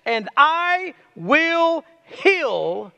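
very slow, rising on I; slow tender promise of God. Acted. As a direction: draw out And I will heal. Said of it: "And then he says this".

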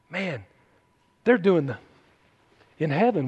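ordinary talk

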